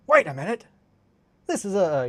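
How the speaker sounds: high, loud startled cry; cartoon quote. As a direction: play the sudden cartoon realization. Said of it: "one of my favorite lines is".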